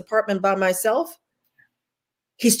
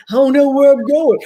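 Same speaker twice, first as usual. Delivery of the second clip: higher, louder anxious voice of someone lost; doubting believer; 6 semitones higher, 6 dB louder than usual. Retold or imitated. Preaching to sisters, voicing the doubter's anxious cry. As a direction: cry out like you can't see the way.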